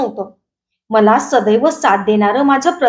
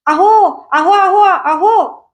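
higher, drawn-out cries of alarm; alarmed calling out; 7 semitones higher, 3 dB louder, much slower than usual. A narrator's reading. Bystanders shouting to the driver, alarmed calling out. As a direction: call out urgently to stop someone. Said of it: "एकदम ओरडा केला".

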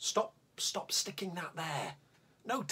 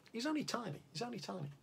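a hushed, much softer repeat; soft aside; 7 semitones higher, 11 dB quieter, faster than usual. Acted. Narrator confides to the children how tiny the troll is, hushed. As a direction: drop to a secret hush.

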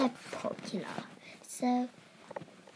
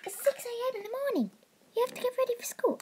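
fast high nagging; 9 semitones higher, much faster than usual. Acted. Sister nags fast.

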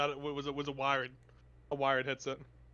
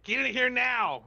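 higher, louder, drawn-out summons; drunk yelling; 8 semitones higher, 5 dB louder, slower than usual. Retold or imitated. Drunk mother calling her son into the living room, imitated yelling. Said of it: "drunk yelling".

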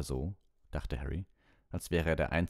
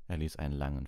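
plain reading voice

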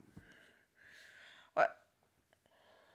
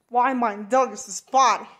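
usual speaking voice

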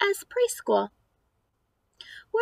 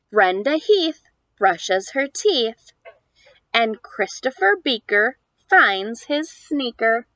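lively, louder picture-book reading; reading to children; 6 dB louder than usual. Acted. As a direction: read the rhymes aloud to a small child. Reads picture book aloud.

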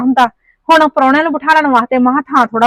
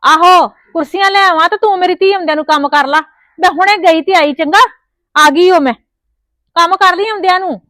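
calling to her daughter across the house, higher and raised; mother calling out her errand to her daughter, raised and high; 6 semitones higher, 3 dB louder than usual. Acted. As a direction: call out to Daljeet in the next room.

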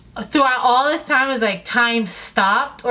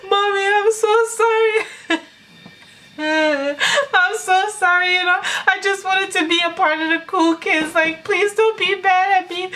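hammed-up blubbering plea to mom; imitating her teary teenage confession; mock crying apology; 9 semitones higher than usual. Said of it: "one piece of crying came out my mouth".

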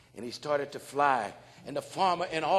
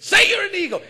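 high, loud laughing order; loud command; 11 dB louder, faster than usual. Retold or imitated. The man loudly ordering the bird. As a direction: push him hard.

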